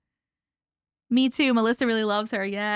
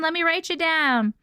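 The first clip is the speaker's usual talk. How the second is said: ordinary talk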